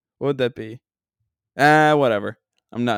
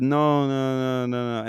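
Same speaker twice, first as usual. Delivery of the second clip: drawn-out fake European refusal; put-on Euro trash accent; slower than usual. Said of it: "in my Euro trash accent".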